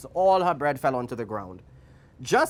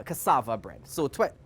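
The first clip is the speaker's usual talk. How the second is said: ordinary delivery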